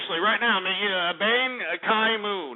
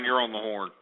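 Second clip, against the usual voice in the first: ordinary talk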